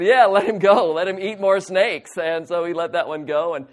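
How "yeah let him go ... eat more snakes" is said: loud, high, gleeful "let him go"; loud delighted cheering; excited call. Retold or imitated. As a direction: cheer the snake on like a fan. Cheering on the snake-eater.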